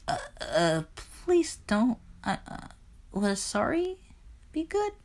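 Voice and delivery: high, halting, small frightened voice; timid pleading. Acted. Persephone begs angry Hades, timid and frightened. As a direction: stammer a meek apology in a soft squeak.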